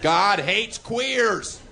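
high, slow, drawn-out protest chant; mock protester chanting a slogan. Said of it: "That's me pretending to be a right-wing redneck radical protesting".

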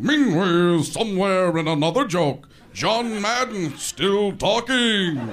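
loud, drawn-out announcer bellow; cut-in announcer. Acted. Guy barging in after a joke didn't work, booming announcer. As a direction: boom it out like an announcer. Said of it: "that guy would come in and go".

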